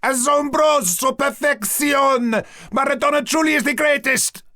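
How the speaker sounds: high, loud football-fan rapture; shouting. A narrator's reading. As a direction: shout it with wild joy. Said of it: "he shouted".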